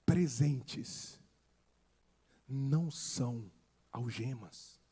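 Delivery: low, hushed, spaced out with long pauses; quiet first take. Shown as a demonstration. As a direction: drop the voice and let each word hang. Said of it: "Eu vou falar bem devagar". (dito baixinho, com pausas longas)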